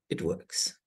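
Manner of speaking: quietly whispered, low: it works; whispered assurance. Real, not acted. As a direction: let it drop to a hushed whisper. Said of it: "what I do know".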